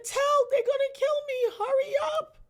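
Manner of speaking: very high, loud desperate scream; frantic retold scream down the phone; panicked screaming. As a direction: scream for help as if dying.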